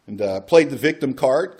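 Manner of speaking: normal talking voice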